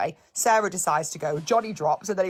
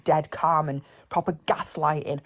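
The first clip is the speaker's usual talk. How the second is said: ordinary talk, nothing marked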